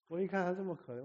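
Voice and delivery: ordinary delivery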